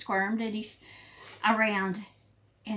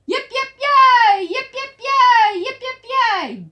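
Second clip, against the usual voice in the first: loud, high celebratory whoops; high-pitched cheering; 12 dB louder, slower than usual. A narrator's reading. Prairie dogs celebrate their watchdog, high loud cheering. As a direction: cheer at the top of your voice, stretching each yay.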